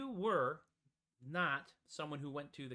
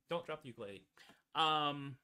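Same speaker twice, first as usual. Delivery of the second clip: ordinary talk, nothing marked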